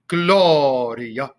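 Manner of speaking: ordinary talk, nothing marked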